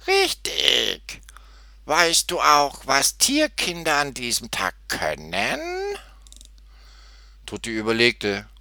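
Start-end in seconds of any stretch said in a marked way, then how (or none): 0.1-5.6 high, slow, grumpy goat voice; bleating goat. A narrator's reading. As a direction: bleat the question out unwillingly. Old goat's figure voice.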